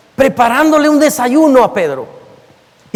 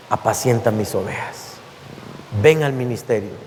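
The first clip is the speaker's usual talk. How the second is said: much softer, tender and low-key; gentle calling; 12 semitones lower, 8 dB quieter than usual. Acted. Jesus calling Peter back to ministry, soft and tender. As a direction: soften right down, a gentle invitation.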